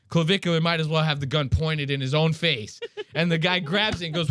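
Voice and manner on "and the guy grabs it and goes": ordinary delivery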